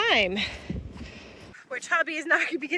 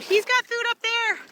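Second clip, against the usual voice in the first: high sing-song coaxing; coaxing the cows; 8 semitones higher than usual. Real, not acted. Farmer telling her cows the food is coming, high coaxing. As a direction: coax them along like pets.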